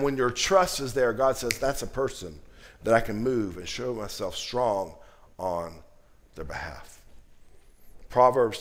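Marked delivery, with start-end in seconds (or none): 1.6-7.0 soft, fading to a near hush; tender voice of God. Acted. Preacher voicing God's promise, softening to a hush. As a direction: speak God's promise gently, trailing off.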